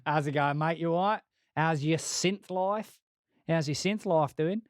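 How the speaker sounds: light mock Australian accent, "how's it going, mate"; chirpy Australian accent asking Trent how he's going. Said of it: "he's gone Australian all of a sudden".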